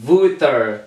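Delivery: usual speaking voice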